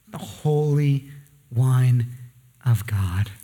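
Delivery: low, slow, menacing demon. Acted. Demon addressing Jesus in the synagogue, low and menacing. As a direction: drag it down into a dark growl. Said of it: "That's the demon".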